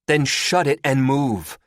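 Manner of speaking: loud, high, slow command; impatient order. A narrator's reading. Chrissy to stalling Terry, impatient order. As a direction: order it firmly, spacing the words.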